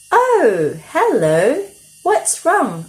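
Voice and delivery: high, bright little rabbit. A narrator's reading. Rabbit greeting the upset girl, high and friendly. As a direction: squeak it out as a friendly bunny. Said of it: "asked Rabbit".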